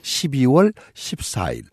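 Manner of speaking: "십이월 십사일" said slowly, each syllable spaced; slow emphatic repeat. Shown as a demonstration. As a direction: stress "십이월" with a falling tune. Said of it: "다시 한번 말씀드립니다". ("십이월 십사일" 또박또박 천천히 강조)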